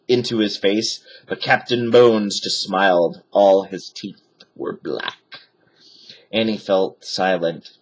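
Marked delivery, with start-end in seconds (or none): none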